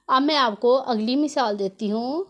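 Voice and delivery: ordinary delivery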